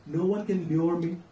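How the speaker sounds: usual speaking voice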